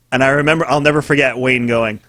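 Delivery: usual speaking voice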